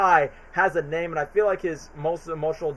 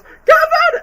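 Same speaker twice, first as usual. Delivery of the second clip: very high, loud shrieked name; hammed-up recreation of the famous scream; imitating the scream; 11 dB louder than usual. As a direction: shriek it like a panicked mother. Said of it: "recreated the scene on social media that just goes".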